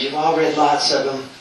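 usual speaking voice

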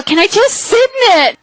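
high, whiny mock begging; retold pleading. Woman begs friend to sit in coffin, mock pleading.

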